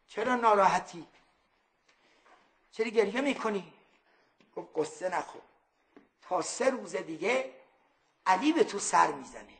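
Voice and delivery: soft, low, tender reassurance; gentle consoling. Acted. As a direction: comfort him softly like a kind elder.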